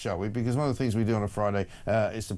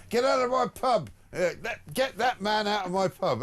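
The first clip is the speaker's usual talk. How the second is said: high, hammed-up yell of an angry landlord; mock furious landlord; 10 semitones higher than usual. Host mimicking the pub landlord from the clip, hammed-up high yell. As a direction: throw him out of the pub, hammed up and much higher.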